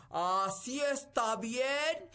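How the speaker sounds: slow, high, loud call from far up; shouting from above. Acted. Giant charro shouting down to the girl, slow high loud call. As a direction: call down from a great height. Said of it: "le gritó".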